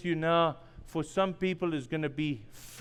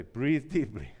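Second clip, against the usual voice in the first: soft and much lower, soothing; calm coaching; 11 semitones lower, 8 dB quieter than usual. Retold or imitated. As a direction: talk them down gently.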